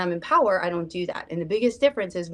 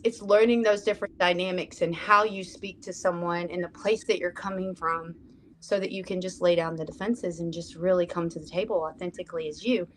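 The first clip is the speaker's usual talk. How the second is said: normal talking voice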